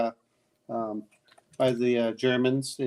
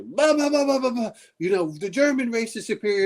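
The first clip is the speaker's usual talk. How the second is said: high-pitched mocking blather; mock ranting soldier; 8 semitones higher than usual. Tied-up SS man boasting to his captors, mock ranting. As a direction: imitate a pompous rant, pitched well up.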